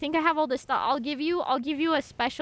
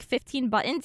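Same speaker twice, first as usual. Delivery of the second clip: usual speaking voice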